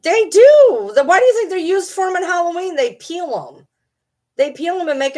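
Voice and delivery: fired-up disbelief; excited rant. Real, not acted. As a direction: get worked up about it. Excited rant about olives.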